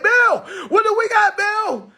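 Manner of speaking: high, loud chummy call; mock buddy shout. To officer Bill, a high friendly shout. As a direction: holler his name like an old friend. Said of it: "I said".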